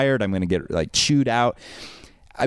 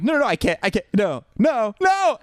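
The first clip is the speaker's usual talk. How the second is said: high-pitched pleading, climbing with each no; desperate protest; 12 semitones higher than usual. Retold or imitated. Line-cutter protesting to the waiting crowd, desperate. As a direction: act out frantic begging that rises in pitch.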